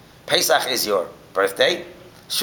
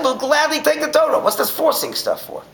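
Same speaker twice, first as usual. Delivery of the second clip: eager acceptance; 14 semitones higher, 3 dB louder than usual. Retold or imitated. People eagerly accepting the Torah.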